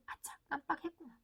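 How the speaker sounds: acted little "oops" under the voice; child's inner thought. Child silently realises they forgot, hushed aside. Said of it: "애가 속으로".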